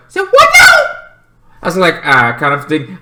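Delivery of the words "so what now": very high, loud pleading squeak; a hammed-up high question; mock whiny high voice; Lena asking. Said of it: "Lena's like".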